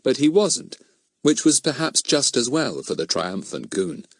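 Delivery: ordinary delivery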